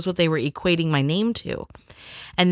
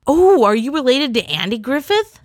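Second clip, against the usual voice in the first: mock teasing classmates; 7 semitones higher, 3 dB louder than usual. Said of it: "I was made fun of".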